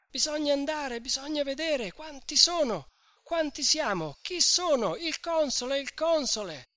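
high, excited crowd voices talking over each other; a panicked village crowd; agitated crowd. A narrator's reading. Tumultuous crowd consultation.

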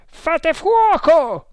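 very high, loud, stretched-out order; yelled command. A narrator's reading. Man on the terrace ordering riflemen, slow high yell. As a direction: yell the order with long vowels. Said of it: "urlò una voce".

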